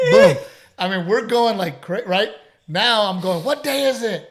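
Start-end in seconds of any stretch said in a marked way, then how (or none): 3.4-4.3 high call to a rowdy table; raised, lively call. Retold or imitated. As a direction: holler the question to the crowd. Calling out to the table.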